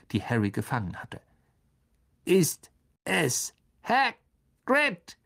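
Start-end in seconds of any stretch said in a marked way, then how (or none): 2.2-5.2 hoch und langsam gedehnt; alte blinde Riesenspinne. Acted. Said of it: "sagte Aragog". (Aragog fragt nach Hagrid, hoch und gedehnt)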